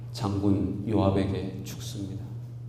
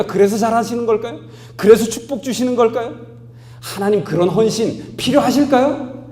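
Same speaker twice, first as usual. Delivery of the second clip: higher and louder, driving and emphatic; full-force preaching; 12 semitones higher, 10 dB louder than usual. Acted. Pastor rousing congregation, full-force preaching. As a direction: pound the point home to the congregation.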